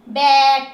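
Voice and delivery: बैट loud, with the "ai" drawn out; long sound. Shown as a demonstration. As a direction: hold the vowel of बैट long. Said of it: "long sound में दे रही हूँ". (बैट ज़ोर से, लंबी "ऐ" के साथ)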